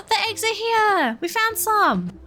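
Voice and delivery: gasping, high and excited. Real, not acted. Streamer spots the first Easter eggs, gasping excitedly. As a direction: burst out with the find.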